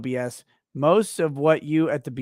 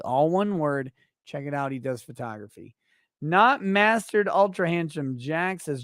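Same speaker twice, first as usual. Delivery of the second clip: ordinary talk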